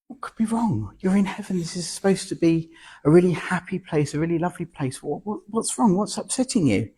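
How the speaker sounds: kind, puzzled concern; storyteller voicing Jesus comforting a crying man; Jesus in the joke. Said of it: "he approaches the man and he says".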